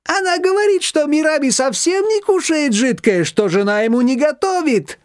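high, playful gossip; teasing gossip. A narrator's reading. Shushana relaying gossip to Nina, teasing. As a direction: pass on the rumour with relish. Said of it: "хохотнула Шушана".

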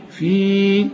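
"في" with a sustained long ee; prolonged stop. Shown as a demonstration. Teacher showing how to stop on the word, prolonged stop. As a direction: hold the vowel of "في" long. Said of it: "لكن عند الوقف تقول إيه".

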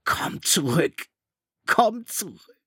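high, slowed cry breaking apart; voice cracking wildly. A narrator's reading. Ralph's voice cracking as he cries after the ship. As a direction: let the voice crack in despair. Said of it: "seine Stimme überschlug sich irre".

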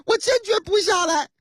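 拔高调门坚决顶回去; 演司机倔着不肯听; 执拗拒绝. Acted. 司机拒绝警察下车要求. (高调门耍倔)

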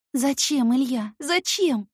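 high loud anguished repetition; repeating desperately. Acted. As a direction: keep asking why, wound up. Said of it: "Твержу, как заведённая".

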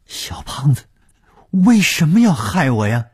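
high, loud, startled cry; astonished question. A narrator's reading. Rescued victim asking why he was harmed, astonished. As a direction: ask it in shock, pitch shooting right up. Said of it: "舒子银吃惊地问".

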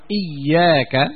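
"إياك" with i-vowel hamza and doubled ya; kasra and shadda. Shown as a demonstration. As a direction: say "إياك" as iyyāka, slow and drawn out. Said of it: "بكسر الهمزة". ("إياك" بكسر الهمزة وتشديد الياء)

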